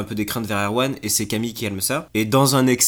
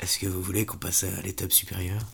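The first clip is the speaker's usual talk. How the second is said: softer, seductive smooth-talker; suave voice; 3 semitones lower, 7 dB quieter than usual. Acted. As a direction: purr the offer softly. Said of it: "Il a une voix très suave".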